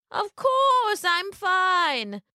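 high, very slow fake granny; wolf as grandmother. A narrator's reading. Wolf reassuring Little Red, high drawn-out fake voice. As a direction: stretch it high, over-reassuring.

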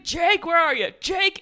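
high, loud mock holler; imitated screaming. Friend searching the hospital for Jake, imitated screaming. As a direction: call out frantically, hamming it up. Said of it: "He's, like, screaming".

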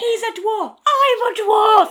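high, very loud comic scream; over-the-top shouted meltdown; hammed-up screaming. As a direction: bellow it slowly in mock outrage. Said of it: "screaming about being a dwarf".

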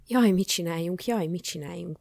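loud lament, then easing off; helpless fretting. A narrator's reading. Baffled sages at a loss, helpless fretting. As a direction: fret like a flustered adviser. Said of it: "tanácstalankodtak a bölcsek".

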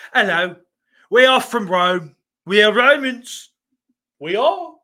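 loud, high, overdone British Roman actor voice; hammed-up British accent, bruv at the end; mock British Roman. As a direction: play a pompous British Roman insisting he's honest.

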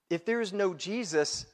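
ordinary delivery

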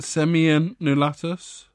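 slow "Simeon Nulatus", going up at the end; careful, unsure "Simeon Nulatus" with a final upturn; questioning rise. Real, not acted. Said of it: "It's the way it goes up at the end".

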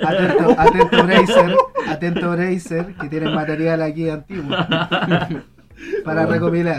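calling out laughing. Real, not acted. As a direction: shout it across while laughing.

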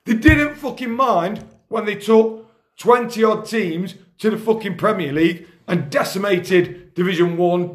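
usual speaking voice

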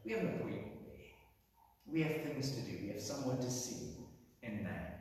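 low, hushed and deliberate; a hushed, purposeful Jesus; quiet, solemn Jesus. Retold or imitated. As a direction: speak it softly, like a quiet promise. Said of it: "but I can imagine him saying".

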